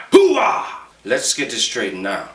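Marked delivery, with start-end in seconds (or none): 0.1-0.9 loud gung-ho soldier. Retold or imitated. Soldier catchphrase shout.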